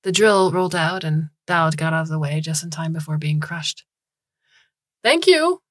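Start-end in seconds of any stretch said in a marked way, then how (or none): 5.0-5.6 high, loud, singsong thanks; cheerful call. A narrator's reading. Bloom thanks the caravan after unloading, cheerful call. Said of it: "Bloom called out cheerfully".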